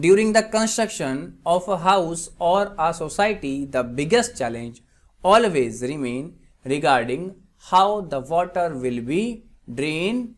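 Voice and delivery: very slow, spaced-out example reading; slow dictation. Shown as a demonstration. Teacher gives students an English translation, slow dictation. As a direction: read it out slowly, phrase by phrase. Repeats example sentence slowly.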